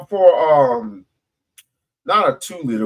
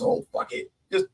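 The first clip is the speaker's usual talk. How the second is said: whispered mock line; 14 semitones higher, 10 dB quieter than usual. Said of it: "and goes".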